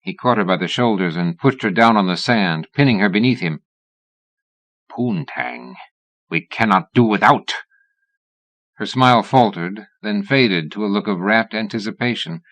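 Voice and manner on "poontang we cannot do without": playful put-on Russian accent. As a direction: lightly ham up a Russian accent to tease her. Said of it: "adopting a Russian accent".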